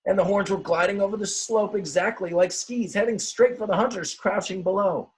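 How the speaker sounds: high, rising excitement in the narration; excited storytelling. A narrator's reading. Reading the wild tumble to children with mounting excitement.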